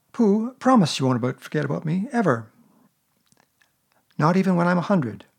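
put-on a small boy catching on; boyish Christopher Robin. A narrator's reading. Christopher Robin voiced higher.

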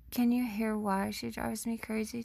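ordinary talk

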